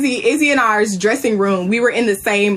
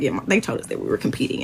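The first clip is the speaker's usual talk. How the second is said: whispered, much softer confession; sheepish whisper; 9 semitones lower, 8 dB quieter than usual. Retold or imitated. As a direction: whisper it like you got caught. Her meek answer to her husband.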